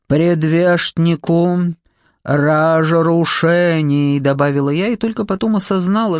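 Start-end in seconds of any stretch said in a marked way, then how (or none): 0.1-4.2 very slow, mushy "Предвестнику разрушений"; thick-mouthed, laboured "разрушений"; mumbling. A narrator's reading. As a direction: drag out "разрушений" with zh for z, as if the jaw hurts. Mumbled addition through swollen jaw.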